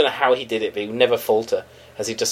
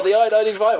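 "I ninety-five" in a higher mock American accent; higher, hammed-up American road name; 7 semitones higher than usual. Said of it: "I've gone American".

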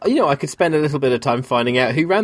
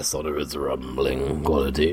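put-on deep rumble, drawn out; sonorous rumbling voice; 11 semitones lower, 6 dB quieter, slower than usual. Co-host with a cold envying bass singers, mock sonorous rumble. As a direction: drop low and slow, sinking further at the end. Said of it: "very sonorous, rumbly quality".

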